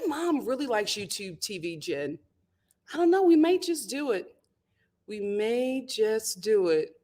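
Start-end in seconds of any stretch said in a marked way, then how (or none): none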